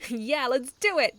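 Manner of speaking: loud, slow gleeful agreement; grinning eager cheer. A narrator's reading. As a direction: agree with a grin. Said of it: "Icky grinned".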